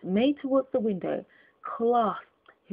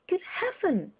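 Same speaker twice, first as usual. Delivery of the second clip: a sudden high cry of wonder; astonished exclamation; 9 semitones higher than usual. Acted. Scrooge sees his boyhood countryside, astonished. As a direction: gasp it out, amazed at what he sees. Scrooge amazed at childhood place.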